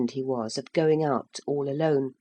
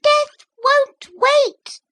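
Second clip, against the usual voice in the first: very high, much louder, very slow little voices; a solemn tiny warning; very high thin voices, slow and grave; grim tiny mussel voices; 10 dB louder, much slower than usual. A narrator's reading. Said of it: "said the little voices".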